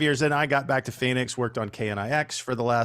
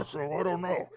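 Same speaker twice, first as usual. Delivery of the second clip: muffled as through a mask; 4 semitones higher, 7 dB quieter than usual. Shown as a demonstration. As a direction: talk with a hand over your mouth. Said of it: "it was a very muffled impression. It sounded like this here".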